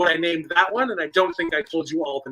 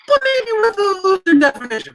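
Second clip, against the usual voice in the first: squeaky hedge; 13 semitones higher than usual. Acted. Sheepish hedge about category.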